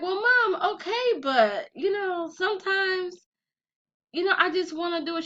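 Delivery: high pleading excuse; talking up high, defending yourself; whiny defense. Retold or imitated. Daughter pleading her case to mom, high and whiny. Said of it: "And I was like".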